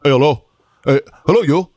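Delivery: high-pitched ranting Colombian sergeant impersonation; Sergeant Catalan. Retold or imitated. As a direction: start with halting hellos, build to a high, scolding tirade. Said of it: "this is a spot-on, uh, impersonation".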